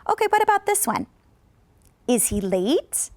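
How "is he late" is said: slow, "late" rises at the end; slow yes-no question rise; slow rising question. Shown as a demonstration. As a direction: lift "late" slowly. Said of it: "that would be going up".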